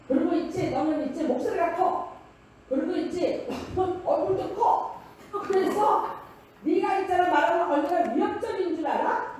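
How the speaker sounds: high, loud imitation of an aggrieved friend; worked-up mock accusation, pitched high; friend's heated complaint. Retells small friend's heated complaint.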